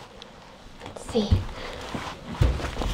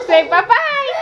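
laughing. Real, not acted. Laughing with baby.

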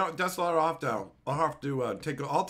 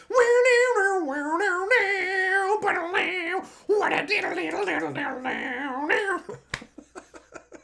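long high howling laugh; hysterical laughing; 14 semitones higher, 6 dB louder than usual. Real, not acted. Streamer cracking up at Dominic's donation, howling laugh. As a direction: lose it completely, laughing loud.

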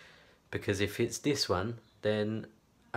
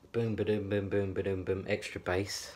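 beatbox bass. Pretend. Imitates bass beat.

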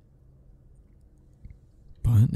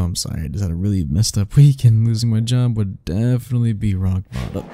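usual speaking voice

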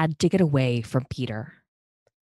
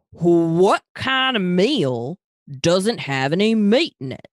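slow Texan drawl, higher and louder; 5 semitones higher, 4 dB louder, slower than usual. Acted. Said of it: "Talia asked in a Texan drawl".

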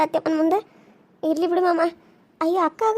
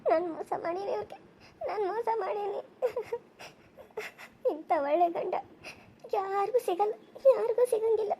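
much softer, sobbing through the words; quiet crying; 3 semitones higher, 12 dB quieter than usual. Acted. Wife alone, crying softly over betraying her husband. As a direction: weep to yourself in guilt.

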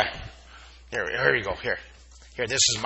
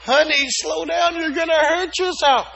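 worried mom; 6 dB louder than usual. Pretend. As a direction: caution like a fussing mother. Teacher voices worried mom.

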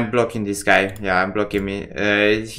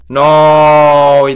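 real loud dismay; anguished shout; 3 semitones higher, 11 dB louder than usual. Real, not acted. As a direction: yell at the losing card. Watching the losing card land.

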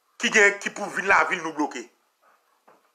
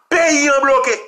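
high, loud mimicked claim; mimicked outcry; 7 semitones higher, 5 dB louder, slower than usual. Commentator quoting journalists, loud outcry. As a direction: cry it out as the journalists' alarm.

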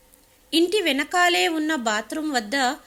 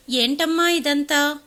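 usual speaking voice

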